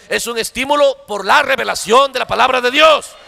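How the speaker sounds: forceful sermon delivery, phrase endings punched; driving revival-preacher cadence; fervent preaching. Real, not acted. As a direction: preach it hard to the congregation.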